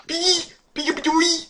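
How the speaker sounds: very high, loud squeaky alarm; squeaky panicked nonsense; startled squealing. Real, not acted. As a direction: squeal it out in tiny high bursts.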